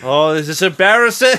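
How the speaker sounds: high, loud put-on lament; mock embarrassment. Marty flailing at the dinner party, mock embarrassed. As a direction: play a comically mortified dinner guest.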